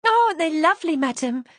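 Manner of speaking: high, loud gushing delight. A narrator's reading. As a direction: maid gushing over the roses. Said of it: "she said with delight".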